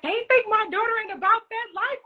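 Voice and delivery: high, proud sassy mom voice; mama bragging about her girl; imitating mom. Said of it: "She was like".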